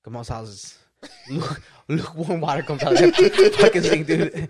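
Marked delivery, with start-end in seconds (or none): 1.3-4.5 laughing. Real, not acted. Repeated line, laughing take.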